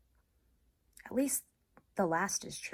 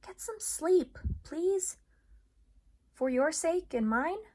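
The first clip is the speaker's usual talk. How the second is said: higher, coaxing dog voice; gentle Bob; 10 semitones higher, 5 dB louder than usual. Acted. Bob urging Ivan to sleep, gentle coaxing. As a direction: coax him to rest. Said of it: "Bob says gently".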